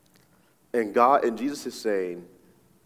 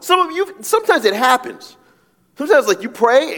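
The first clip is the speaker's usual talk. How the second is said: sudden excited squeal; 10 dB louder than usual. Real, not acted. Excited pitch jump mid-sermon.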